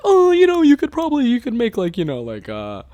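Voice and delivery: musing, swooping high-pitched ums and suggestions; imitating patient friend. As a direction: play the nice guy pondering ideas. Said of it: "being a nice guy and having patience".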